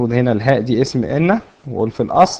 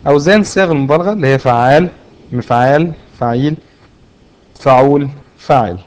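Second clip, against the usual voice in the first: emphatic recap; 2 semitones higher, 5 dB louder than usual. Shown as a demonstration. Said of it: "يبقى الخلاصة بقى".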